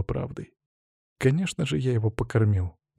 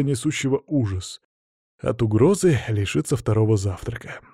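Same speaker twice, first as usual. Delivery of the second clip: ordinary delivery, nothing marked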